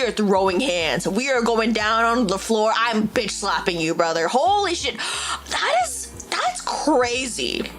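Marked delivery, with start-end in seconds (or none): none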